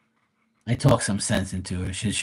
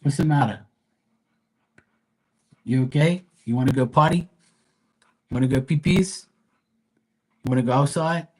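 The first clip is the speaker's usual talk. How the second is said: doting pet talk; 5 semitones higher than usual. Real, not acted. Streamer fussing over her panting dog, high doting baby talk.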